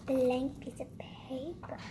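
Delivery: slow hushed whisper; breathy, soft, slow whisper. Pretend. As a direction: whisper it, drawing the words out. Whispered aside in intro.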